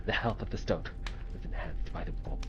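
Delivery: hushed game narration. Acted. Streamer reading the game's story text in a hushed voice. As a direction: read the game's story lines low and hushed.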